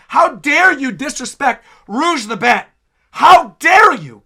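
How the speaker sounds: loud, hammed-up indignation, hitting DARE; affronted fan, playing it up loudly; mock outrage. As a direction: scold with playful, exaggerated offense. Mock outrage at chat member's slight.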